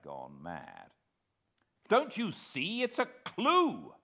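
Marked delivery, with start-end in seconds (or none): 1.9-3.9 high, loud, excited shout. A narrator's reading. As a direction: shout the discovery at them. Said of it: "I shouted".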